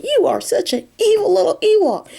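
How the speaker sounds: high, outraged accusation; shrill mock outrage at her. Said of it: "he's outraged".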